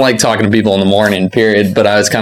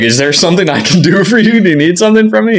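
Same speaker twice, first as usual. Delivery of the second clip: much higher-pitched, puzzled asking; retold flustered question; 10 semitones higher, faster than usual. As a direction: re-enact your baffled question, pitch climbing high. Retells own flustered question to mayor.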